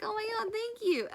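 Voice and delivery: gushing thanks. Retold or imitated. Thanking the friend for chocolates, high gushing. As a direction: gush over the gift.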